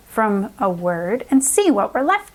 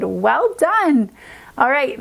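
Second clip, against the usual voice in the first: ordinary delivery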